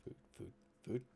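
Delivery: breathy fish chant; gurgling fish man croak. Acted. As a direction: gurgle food over and over. DM voices the hungry fish.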